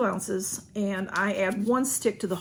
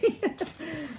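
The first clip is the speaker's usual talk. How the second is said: light giggle; 7 semitones higher, 4 dB quieter than usual. Real, not acted. Laughs at own tissue comment.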